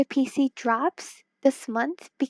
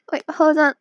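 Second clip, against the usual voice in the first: usual speaking voice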